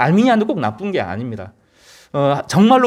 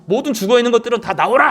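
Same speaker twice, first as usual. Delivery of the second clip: imitated calling out; 8 semitones higher than usual. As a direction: call out as if waking all the dead. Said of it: "큰 소리를 지르시고". (죽은 자들을 불러내듯 높이 외치며)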